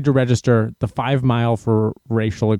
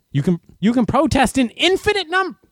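sarcastic; 10 semitones higher than usual. Acted. Sarcastic rant about protest rules.

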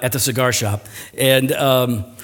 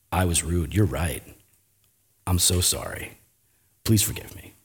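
soft, low retold apology; subdued, sincere admission of fault; humble apology; 8 semitones lower, 7 dB quieter than usual. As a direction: apologize quietly, with pauses.

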